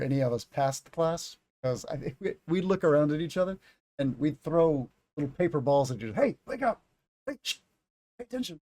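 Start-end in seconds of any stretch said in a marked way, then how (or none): 6.1-8.6 classmate whisper-shout. Retold or imitated. Classmates hushing each other in class.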